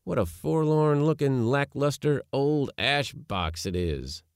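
put-on boyish disdain. A narrator's reading. Nephew mocking his uncle's invention, boyish disdain. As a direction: scoff at it with boyish scorn. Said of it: "without heeding my boyish disdain".

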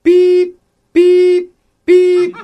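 high, loud, sustained beeps; evenly spaced piercing beeps; imitated truck backup beep. Shown as a demonstration. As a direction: beep like a reversing garbage truck. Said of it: "Like this?".

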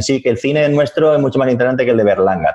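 a hint of a proud Italian; mock Italian boaster. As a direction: tease the Italian who brags about his cinema. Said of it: "viene uno italiano y dice".

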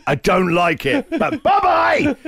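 slow, high, hammed-up yell of bye-bye; mock shouted goodbye. To the daughter's boyfriend at the door, a mock shouted goodbye.

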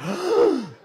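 high, loud mock gasp; big acted gasp of realisation; shocked gasp. Acts shocked reaction to bat hit.

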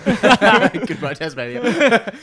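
high, laughing goodbye. Real, not acted. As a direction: laugh through the farewell. Laughing farewell joke.

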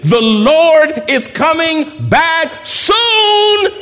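very slow, high, full shout; shouted like he means it. Shown as a demonstration. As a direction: throw your whole voice into it, every word long. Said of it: "say it like you mean it".

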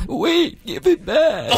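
high, urgent mock struggle; imitated mom fighting her alter ego; two-character phone fight. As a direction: grab for the phone in a put-on high voice. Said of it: "She's playing two characters".

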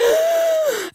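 sharp high sob-gasp; emotional gasp. Retold or imitated. Mum overwhelmed by the neighbour, high tearful gasp. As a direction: gasp like about to cry. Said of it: "I was so emotional that I was just like".

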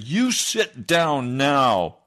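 very slow, loud and final, each word weighed; slow stern command. Retold or imitated. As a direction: point at him and lay down the law. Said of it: "I pointed my right index finger at him".

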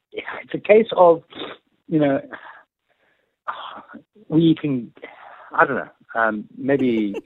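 halting, with long pauses; hesitant, losing the thread mid-sentence; stage fright. Real, not acted. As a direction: stumble along, searching for the words. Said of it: "Getting a bit of stage fright there".

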